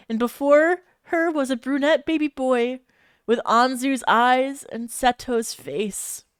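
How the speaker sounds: usual speaking voice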